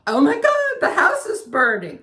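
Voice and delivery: loud, high panicked cry; panicked shout. Retold or imitated. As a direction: cry out in sudden alarm. Sees the shed burning.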